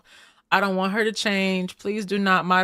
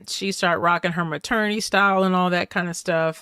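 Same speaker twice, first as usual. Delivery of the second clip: ordinary delivery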